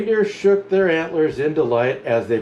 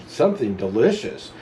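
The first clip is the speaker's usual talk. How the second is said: ordinary delivery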